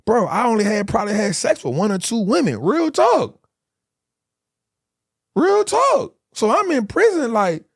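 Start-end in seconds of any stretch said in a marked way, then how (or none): 2.6-6.2 much higher, louder real talk; rising, louder insistence; emphatic. Real, not acted. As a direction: hammer it home.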